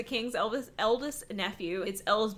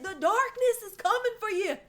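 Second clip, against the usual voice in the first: high, far-off, hammed-up spooky voice; mock ominous Emery; 11 semitones higher than usual. Mocking Emery's ominous line to her co-host. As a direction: play the creepy hero as a joke. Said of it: "he's like".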